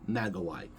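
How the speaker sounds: "magoi" with a hard g, mag-o-eye; Greek pronunciation. Shown as a demonstration. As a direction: say "magoi" as in Greek, low and soft. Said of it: "it's probably pronounced".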